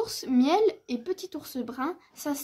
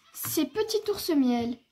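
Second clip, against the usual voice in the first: ordinary delivery, nothing marked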